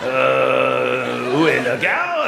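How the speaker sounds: put-on drawn-out French accent with long hesitating euhs; slow, halting French speaker stuck on the next word. Mocking French people hunting for words, in a thick halting French accent. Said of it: "They go".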